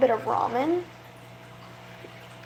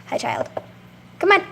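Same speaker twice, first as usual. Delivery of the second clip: pet talk; 5 semitones higher than usual. Real, not acted. Greeting tiny frog.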